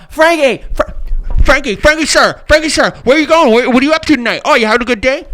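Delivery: loud, high, eager calling out after someone; pushy paparazzi. Pretend. Paparazzo chasing Frankie, calling loudly and eagerly. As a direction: chase him down the street shouting his name.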